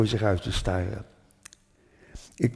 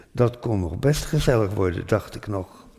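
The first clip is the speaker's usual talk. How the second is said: ordinary delivery, nothing marked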